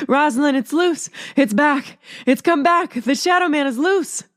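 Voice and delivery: high, urgent, frightened pleading; frantic warning. A narrator's reading. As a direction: blurt out the news in a panic. Sister's frantic warning at night.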